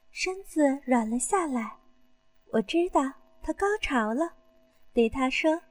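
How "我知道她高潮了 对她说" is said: usual speaking voice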